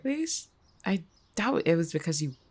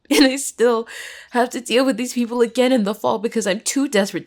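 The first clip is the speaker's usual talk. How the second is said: bitter despair; 2 semitones higher, 4 dB louder than usual. Acted. Student admits hope of changing things failed, anguished. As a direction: pour out bitter regret at having hoped. Jess despairs at being passed over.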